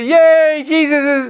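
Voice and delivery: high, drawn-out mock yay; mock cheering. Everybody supposedly welcoming Jesus' kingdom, mock cheering. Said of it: "that everybody goes".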